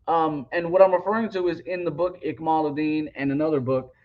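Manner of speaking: ordinary talk, nothing marked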